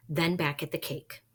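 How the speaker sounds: ordinary delivery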